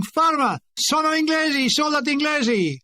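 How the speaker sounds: frantic calling out to soldiers on the hillside; shouting. Retold or imitated. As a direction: call out to the armed police that you are English soldiers. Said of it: "I'm shouting".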